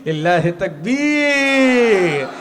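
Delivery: high, loud chanted sermon cry; preaching at full force. Acted.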